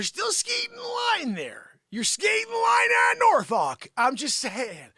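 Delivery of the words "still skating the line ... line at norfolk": high, strained scolding. Acted. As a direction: warn in a high comic voice. Acted scolding.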